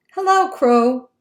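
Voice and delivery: loud call to a passing crow; shouted greeting. Acted. Toad greeting the crow from the sled, shouting. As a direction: call up to the bird. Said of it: "shouted Toad".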